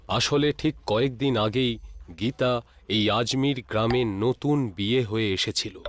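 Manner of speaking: ordinary delivery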